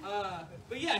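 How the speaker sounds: ordinary talk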